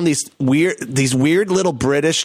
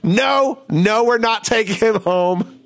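dads refusing; 6 semitones higher than usual. Retold or imitated. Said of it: "the dads were like".

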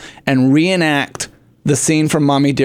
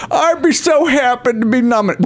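high, lofty grande dame; snobby aristocratic voice; 7 semitones higher than usual. Pretend. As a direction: gush it like an aristocratic star. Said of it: "snobby aristocratic voice".

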